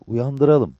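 lively comrade making the call; Cabbar. A narrator's reading. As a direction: lift the voice and propose it. Cabbar decides to wake him.

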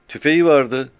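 put-on young boy answering fast; shepherd boy. Frightened shepherd boy answering the captain, high child voice.

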